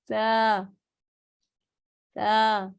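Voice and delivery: تا said gently with no hiss; a soft, unhissed taa. Shown as a demonstration. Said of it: "اس کو نرمی آواز سے پڑھیں گے". (تا نرمی والی آواز سے)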